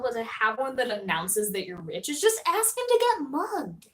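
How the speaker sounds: ordinary talk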